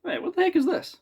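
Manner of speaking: high, startled squeak of disbelief; mock startled double take; surprised quote. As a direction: pop the pitch way up in shock. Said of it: "I'm like".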